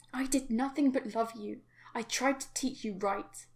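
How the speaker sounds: very loud, wounded yelling; anguished angry shout. Acted. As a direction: scream it as betrayed love. Crying father yells at daughter.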